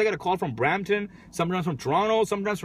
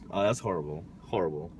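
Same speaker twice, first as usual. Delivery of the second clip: low groan voice; 13 semitones lower, 10 dB quieter than usual. Pretend. Groaning at own pun.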